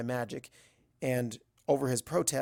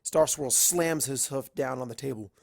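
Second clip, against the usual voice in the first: usual speaking voice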